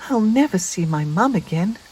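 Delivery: high, lightly voiced little spider, upset; small sad spider. Lost Spinderella missing her mum, high and a little upset.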